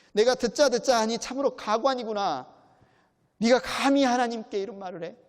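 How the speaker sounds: loud, high scolding, 감히 rising in outrage; indignant rebuke. Retold or imitated. Bildad rebuking Job, loud and indignant. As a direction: scold him from wounded pride. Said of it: "빌닷이 받아들일 수 없는 거죠".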